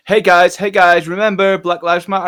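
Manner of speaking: mock corporate cheer. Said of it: "company that's like".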